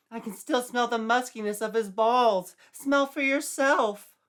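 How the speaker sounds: put-on high, loud, outraged young woman; shrill indignant Sandy. Sandy protests loudly to the sergeant, shrill and outraged. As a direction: squeal it, scandalized and defensive.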